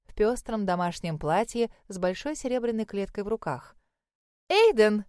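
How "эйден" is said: loud, glad call of his name; joyful exclamation. Acted. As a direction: greet him with delight. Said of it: "радостно воскликнула она".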